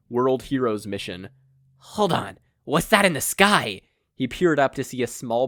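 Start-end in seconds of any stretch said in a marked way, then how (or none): 1.8-3.8 higher, louder, puzzled alarm; rising startled question. A narrator's reading. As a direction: squint up at the sky, alarmed. Cult leader spots something.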